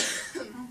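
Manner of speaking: short deliberate cough; forced cough. Acted. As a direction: cough it out on request. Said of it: "a big cough out for me".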